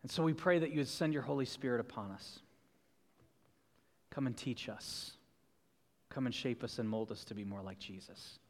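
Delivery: soft, low prayerful voice. Real, not acted. Pastor praying with the congregation before the reading, hushed and low.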